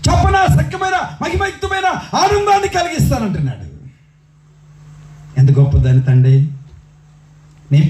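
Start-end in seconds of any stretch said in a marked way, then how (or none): 0.1-3.7 high, ringing sermon cry; fervent preaching. Acted. Preacher voicing God's promise to sinners, high and fervent. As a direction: lift the promise over the crowd.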